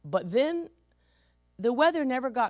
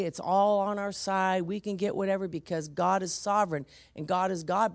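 normal talking voice

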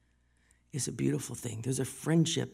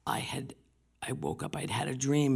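ordinary talk, nothing marked

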